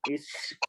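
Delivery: "sh" as a soft hiss; sh sound shown. Shown as a demonstration. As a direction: stretch the "sh" gently. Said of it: "we only pronounce S H sound".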